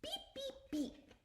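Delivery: high fading beeps; soft beeps. Acted. The little truck driving away, high soft beeps. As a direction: beep softly as it drives off.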